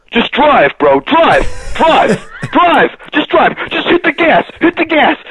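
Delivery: high, urgent pleading to drive; frantic urging. Retold or imitated. Panicked friend yelling at the driver to go. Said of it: "him going".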